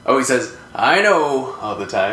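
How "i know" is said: higher, lightly voiced older relative; older relative's catchphrase. Quoting an older relative's habit, higher catchphrase voice. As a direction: imitate a relative's knowing catchphrase, raised. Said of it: "an older relative who".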